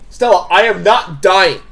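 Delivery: emphatic refusal. Acted. Character refusing to die beside Stella, emphatic loud protest. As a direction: insist loudly on getting out alive.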